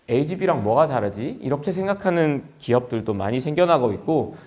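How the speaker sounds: normal talking voice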